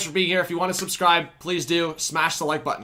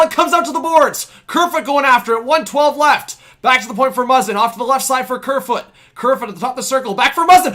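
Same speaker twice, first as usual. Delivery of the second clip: raised, loud live call; hockey caller riding the pressure; excited play-by-play; 10 semitones higher, 11 dB louder than usual. Real, not acted. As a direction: keep the commentary pumped.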